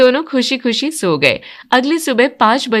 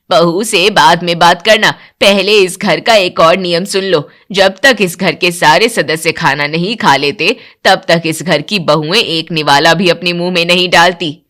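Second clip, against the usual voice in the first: lower, louder commanding voice; strict mother-in-law; 5 semitones lower, 3 dB louder than usual. Acted. Mother-in-law laying down house rules to new bride, stern lower voice. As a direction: lay down the rule like a stern matriarch.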